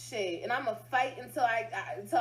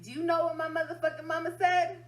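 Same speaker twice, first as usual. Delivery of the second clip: louder, higher repeat of the question; emphatic repeat; 5 semitones higher, 5 dB louder than usual. Real, not acted. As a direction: hit it hard the second time.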